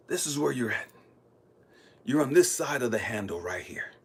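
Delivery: low, soft, patient voice of God; God guiding. Retold or imitated. God showing Elijah where he needs to go, calm and low. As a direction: guide him gently, pausing between instructions. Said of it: "He said".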